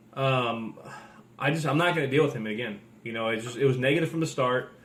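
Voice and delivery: ordinary delivery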